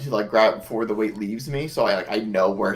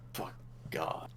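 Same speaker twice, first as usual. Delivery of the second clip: sighed curse; 7 semitones lower, 18 dB quieter than usual. Real, not acted.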